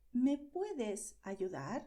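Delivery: slow, clear request for help; slow model question. Shown as a demonstration. Teacher modelling a request for help, slowly. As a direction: ask slowly so learners catch each word. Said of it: "if you use tú".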